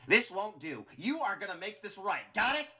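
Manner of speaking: high, loud bossy order; angry demand. A narrator's reading. Flowey ordering Clover to make it right, angry demand. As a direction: lay down the law, rising sharply.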